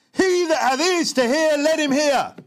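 high, loud, slow scripture shout; shouted cry. Acted. Preacher reading Jesus's call to the crowd, shouted high. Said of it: "This means he shouted".

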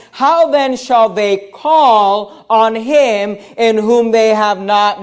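slow, measured reading of a question; deliberate scripture question. Acted. Preacher quoting the apostle's question to the congregation, slow and deliberate. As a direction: stretch the verse out word by word.

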